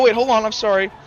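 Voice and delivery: ordinary delivery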